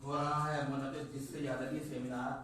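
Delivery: measured Urdu verse recital, phrase by phrase; poem recitation. Acted. Poet reciting couplets at a literary seminar, measured declamation.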